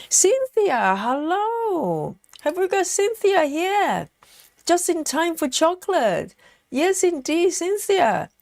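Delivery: light up as a friend arrives; excited greeting. Real, not acted. Greeting viewer Cynthia joining the stream, excited.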